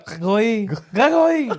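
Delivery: put-on high-pitched French-accented call; French girlfriend calling. Uncle's French girlfriend calling him from the kitchen, high French accent. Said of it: "And she'd just be there".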